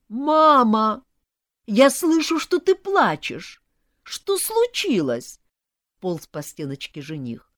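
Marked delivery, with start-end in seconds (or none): 0.1-5.4 loud, high-pitched alarmed calling; anxious son. A narrator's reading. Groom groping toward crying mother, alarmed. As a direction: wail with worry, drawing out the question.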